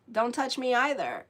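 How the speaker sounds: normal talking voice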